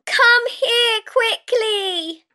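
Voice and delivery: put-on high, drawn-out child's plea; calling out. A narrator's reading. Child calling her mother over, urgent high call. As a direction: stretch it high, summoning someone. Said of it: "she called".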